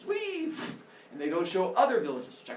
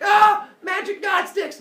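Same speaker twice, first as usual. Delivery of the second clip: higher, louder, slow amazed cry; amazed shout; 12 dB louder, slower than usual. Acted. An early human marvels at the fire sticks, shouting in awe. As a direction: bellow it in wonder at magic sticks.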